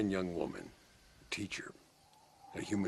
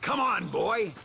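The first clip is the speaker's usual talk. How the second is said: calling out; 14 semitones higher, 6 dB louder, slower than usual. Acted. Urging his horse on.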